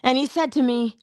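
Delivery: ordinary talk, nothing marked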